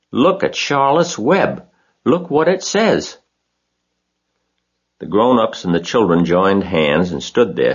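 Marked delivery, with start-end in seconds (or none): 0.1-3.2 put-on high girlish voice, thrilled and eager; excited girl Fern. A narrator's reading. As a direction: Fern showing the family the web, pitched high.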